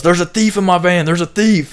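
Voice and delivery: high, loud teasing yell of thief; mock screaming. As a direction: shout it out as a prank accusation. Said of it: "He was screaming that shit as loud as he could".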